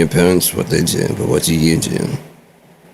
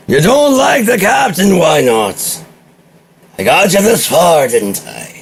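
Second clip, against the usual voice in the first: put-on old sea captain; 9 semitones higher than usual. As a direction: roar it out like a weathered skipper. Captain voice returns to the crew.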